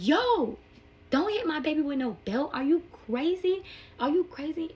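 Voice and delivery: high, loud retold protest; outraged yell. Mother to the dad hitting her baby, outraged yell. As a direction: shout in disbelief, reenacting the outrage.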